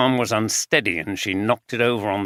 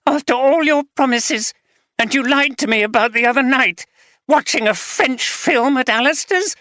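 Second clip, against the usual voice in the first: higher, raised furious tirade; the betrayed wife letting rip; wife accusing husband of gambling, furious; angry wife; 11 semitones higher, 4 dB louder than usual. Acted.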